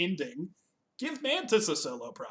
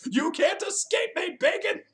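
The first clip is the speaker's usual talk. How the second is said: high, raised-voice imitation of a villainous yell; mock yell; 6 dB louder than usual. Imitating Nebula chasing Kevin Bacon, mock yell. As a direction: yell it high, half laughing. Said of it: "she just yells".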